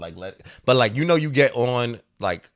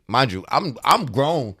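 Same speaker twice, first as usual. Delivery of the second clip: ordinary talk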